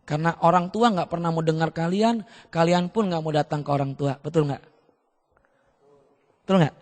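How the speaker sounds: ordinary talk, nothing marked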